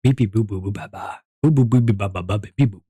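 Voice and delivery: low silly voice. Pretend. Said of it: "Go deeper".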